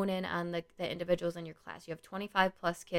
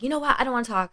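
raised, higher, fed-up snap; mock angry yell; 6 semitones higher, 7 dB louder, much faster than usual. Worker walking in pissed off, acted angry outburst. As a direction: act out losing your temper, loud and high. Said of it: "I was just angry and I yelled".

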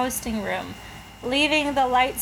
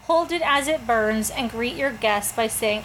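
ordinary talk

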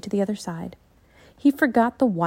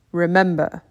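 calm ninja master; 3 semitones lower, 5 dB louder than usual. Acted. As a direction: speak like a wise old teacher.

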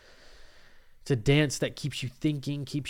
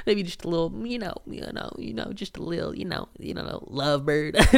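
giggly teasing; 5 semitones higher than usual. Real, not acted. Teasing about a lovey-dovey relationship.

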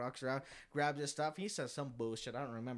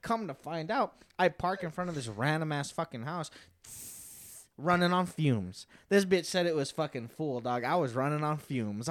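ordinary talk

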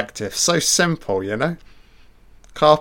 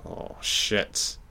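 breathy whispered curse under the breath; hushed oh shit; 8 dB quieter than usual. Real, not acted. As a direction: whisper it, caught off guard. Reacting to scary film moment.

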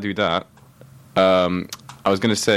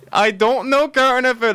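mock whiny friend; 3 dB louder than usual. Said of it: "she's like".

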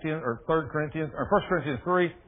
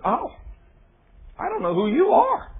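much higher, lightly voiced Jesus; a light hint of Jesus turning away; Jesus disowning; 10 semitones higher, 4 dB louder than usual. Jesus refusing the preacher who lost his power, higher. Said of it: "Jesus said".

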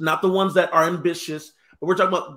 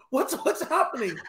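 lost puppy; 4 semitones higher, 3 dB quieter than usual. Retold or imitated. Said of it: "Like, lost puppy".

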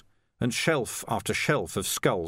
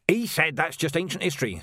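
higher-pitched gravedigger voice; lightly voiced plaintive gravedigger; aggrieved gravedigger; 8 semitones higher than usual. A narrator's reading. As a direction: report it in character, pitched up. Voices Ledgy reporting the deacon.

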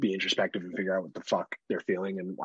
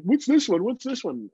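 put-on high, quick child's question; imitating curious toddler; 11 semitones higher than usual. Dad imitates daughter pointing at emotion chart, high child voice. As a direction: ask like a curious little girl. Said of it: "She's looking at, like".

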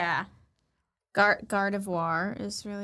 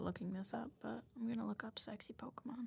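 hushed, unvoiced aside; whispering; 17 dB quieter, faster than usual. Real, not acted. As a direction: whisper it like a guilty secret. Whispering embarrassed search plan.

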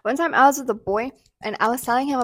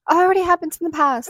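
higher-pitched mock dismissal; mocking imitation; 7 semitones higher, 4 dB louder, much faster than usual. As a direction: lightly mimic someone brushing you off.

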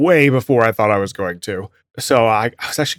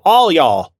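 loud, higher sassy Southern address; 5 semitones higher, 7 dB louder than usual. Shown as a demonstration. Speaker yelling at a group of people, sassy. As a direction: throw it at the whole group with sass. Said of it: "it sounds more sassy".

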